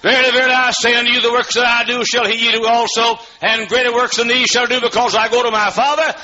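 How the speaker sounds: shouted, high, chanting preacher's climax; preaching at full force. Acted. Preacher crying scripture to his church, sung and shouted. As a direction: lift the whole room with it.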